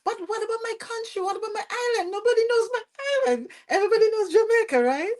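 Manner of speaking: high, fast, indignant protest; rising in offended disbelief; offended inner voice. Retold or imitated. Told how customers keep calling her Jamaican, she protests indignantly. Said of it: "I took offense a bit because I'm like".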